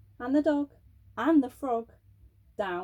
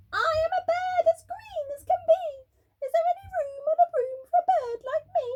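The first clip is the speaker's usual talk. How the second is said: very high, fast bird voice; a shrill little bird begging a ride; squawky bird; 3 dB louder than usual. Acted. Green bird character voice.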